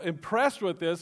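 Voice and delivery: normal talking voice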